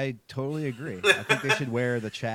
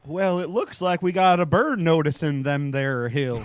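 put-on old prospector; 4 semitones higher, 7 dB louder, slower than usual. Hosts' cowboy-voiced show intro.

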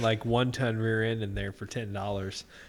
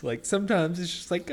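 ordinary delivery

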